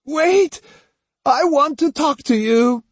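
high-pitched screech to stop; shrieked command. A narrator's reading. Old woman chasing the fleeing Otsu, shrieking at her. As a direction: scream after someone running away. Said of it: "she shrieked again".

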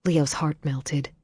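ordinary delivery

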